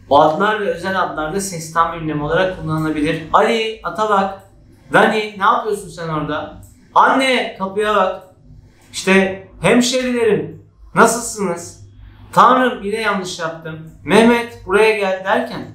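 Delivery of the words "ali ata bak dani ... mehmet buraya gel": raised tune on each name as a call; emphatic calling. Shown as a demonstration. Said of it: "Tonlamamı arttırarak vurgu yoluyla". (tonlamayı artırarak seslenme)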